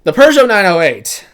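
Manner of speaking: loud triumphant French-accented car name; proud French accent. Shown as a demonstration. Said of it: "you can't do it without an accent".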